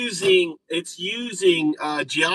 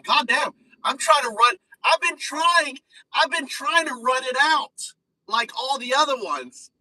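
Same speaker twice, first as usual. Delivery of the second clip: high, frustrated self-quote; mock exasperated rant; 7 semitones higher than usual. Quoting himself griping at Claude Pro, higher and exasperated. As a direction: vent like you're fed up with it. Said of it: "on my Claude Pro going".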